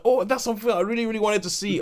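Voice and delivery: high-pitched, stretched-out eager exclamation; eager longing. Acted. Film fan spotting a film he longs to see, eager longing. Acts out own longing reaction.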